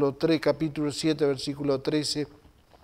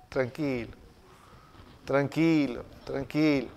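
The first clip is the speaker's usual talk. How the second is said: low, much softer soothing repeats; soft calming; 5 semitones lower, 6 dB quieter than usual. Pretend. Someone calms an angry pastor, low and soft. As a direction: settle someone who's about to explode.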